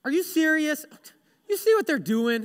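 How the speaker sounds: acted-out exasperation; exasperated disbelief. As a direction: blurt it out, fed up and incredulous. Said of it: "this would be me".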